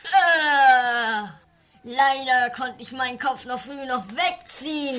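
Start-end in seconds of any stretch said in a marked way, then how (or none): 0.1-1.3 loud pained cry; pained shout. Acted. Kidnapper hit again, pained cry.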